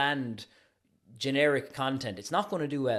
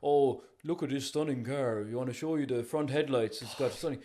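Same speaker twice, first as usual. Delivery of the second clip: bland car salesman. Retold or imitated. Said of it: "just kinda saying".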